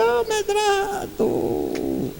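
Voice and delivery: high, drawn-out call of a name from far off; woman calls out to the speaker from across the airfield, high and drawn out. Retold or imitated. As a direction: call across fifty metres. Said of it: "aí ela veio gritando".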